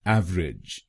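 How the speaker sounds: एवरेज said as ev-rij, two syllables; teacher models एवरेज clipped to ev-rij; two-syllable pronunciation. Shown as a demonstration. Teacher shows students the second way, two-syllable ev-rij. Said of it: "दूसरा है".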